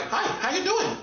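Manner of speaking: hammed-up high voice imitating a Chinese man; mock Chinese man.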